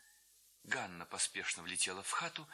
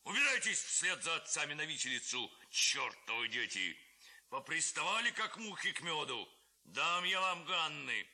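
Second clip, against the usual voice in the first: angry shout; 6 semitones higher than usual. A narrator's reading. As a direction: drive the boys off, yelling. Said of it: "кричал голова". (голова сердито кричит на хлопцев)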